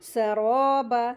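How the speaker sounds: सराबा loud, with rounded, stretched raw; heavy ra. Shown as a demonstration. Teacher models heavy ra, सराबा with long rounded raw. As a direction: thicken and hold the raa of सराबा. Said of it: "सीन की आवाज़".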